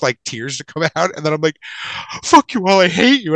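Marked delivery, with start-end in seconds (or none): 2.2-3.3 higher, louder, hammed-up tantrum; mock tearful outburst. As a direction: storm off with fake hurt. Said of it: "I just run screaming, crying".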